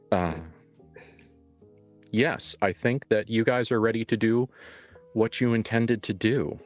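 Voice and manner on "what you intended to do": ordinary talk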